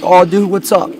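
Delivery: put-on chummy hello; fake friendliness. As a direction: greet him like a pal, insincerely. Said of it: "I was being fake".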